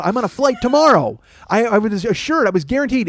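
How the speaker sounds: high, loud insistence; indignant phone complaint; angry, aggressive. Retold or imitated. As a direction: insist on it heatedly, pitch up. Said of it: "I'm angry and I'm aggressive".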